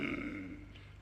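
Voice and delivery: low, soft throat clear; a quiet embarrassed ahem. Acted. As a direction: clear your throat awkwardly. Awkward pause before goodbye.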